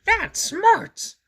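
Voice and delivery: high, loud pained monster voice; Grover voice. Acted. Grover after stubbing his toe, complaining. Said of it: "he said".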